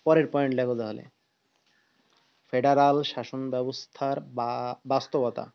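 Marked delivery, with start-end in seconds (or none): 2.5-5.4 slow, word by word dictation; dictating. Shown as a demonstration. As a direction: dictate the heading slowly. (ধীরে ধীরে শ্রুতলিপি বলা)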